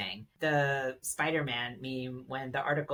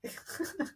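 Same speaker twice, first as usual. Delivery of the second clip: real laugh; 13 semitones higher, 6 dB quieter than usual. Real, not acted. Guest laughs at British voice.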